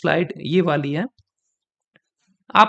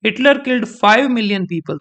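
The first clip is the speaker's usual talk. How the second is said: very slow, five punched; stress on five with a nod; 2 semitones higher, much slower than usual. Shown as a demonstration. Teacher demonstrating the nod, stressing five. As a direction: dip the head on five. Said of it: "five बोलते गर्दन अपने आप थोड़ी सी झुक जाती है".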